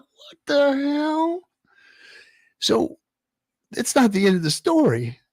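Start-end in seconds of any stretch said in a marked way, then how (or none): 0.0-1.4 much higher, slow, stretched-out shock; shocked disbelief. Retold or imitated. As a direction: drag it out in horror. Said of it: "I'm like".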